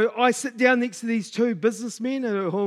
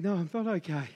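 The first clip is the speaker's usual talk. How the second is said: tearful; 4 semitones lower, 8 dB quieter than usual. Retold or imitated. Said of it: "I just start crying".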